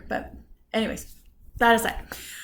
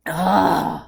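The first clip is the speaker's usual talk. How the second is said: low, loud "ugh"; exasperated groan; 6 semitones lower, 6 dB louder than usual. Real, not acted. Woman done venting about the rude man, exasperated groan.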